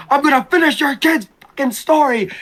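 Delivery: wrestler's threat. Acted. The Rock threatening Mama Rose, acted-out taunt. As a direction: act out a wrestling villain's vow.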